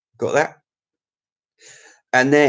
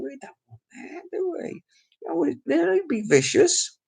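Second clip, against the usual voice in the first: squeaky put-on voice, much higher and softer; mock high voice; 14 semitones higher, 5 dB quieter than usual. Vlogger teasing his live chat in a squeaky high voice. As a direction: tease the viewers in a high silly voice.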